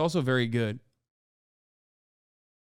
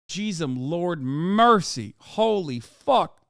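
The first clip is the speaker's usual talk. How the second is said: ordinary delivery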